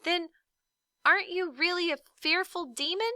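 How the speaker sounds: high, pleading question; desperate boy. A narrator's reading. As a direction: grasp at any hope. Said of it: "Milo asked desperately".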